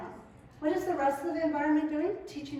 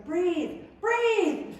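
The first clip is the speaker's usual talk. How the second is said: rising insistent call, louder on the second; urging breathe again and again, climbing higher; nagging breathe; 6 semitones higher, 7 dB louder than usual. Retold or imitated. Wife nagging husband to breathe, rising insistent call. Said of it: "I always say".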